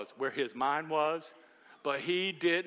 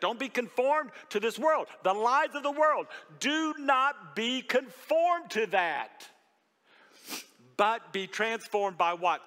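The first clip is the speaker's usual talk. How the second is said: louder, higher, slow preaching; 7 semitones higher, 4 dB louder, slower than usual. Acted. Pastor urging the congregation with Romans twelve, full force. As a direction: drive the verse home to the room.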